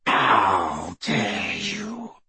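very slow, low werewolf snarl. A narrator's reading. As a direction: snarl it, dragging every word. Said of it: "he snarled".

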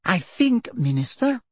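high, silky suggestion; sweetly insinuating. A narrator's reading. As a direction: interject with smooth deference. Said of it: "said Umbridge silkily".

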